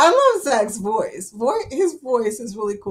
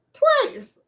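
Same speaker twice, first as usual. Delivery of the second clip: "Twice" jumps up high and is held; emphatic twice. Real, not acted. Telling chat about a cancelled cruise, "Twice" shot up high. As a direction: hit "Twice" high in dismay.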